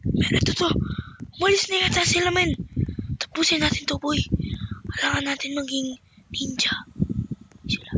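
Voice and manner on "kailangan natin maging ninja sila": whisper. Acted. Sneaking ninja.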